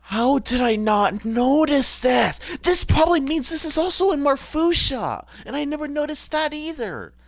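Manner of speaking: high, rising wail of self-reproach; exasperated dismay. Real, not acted. Gamer realising they missed it elsewhere too, high exasperated wail. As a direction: cry it out high, dragging the first words.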